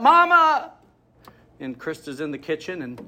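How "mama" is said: high anguished cry for mom; crying out Mama. A narrator's reading. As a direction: wail it like a heartbroken little girl. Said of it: "she cries out".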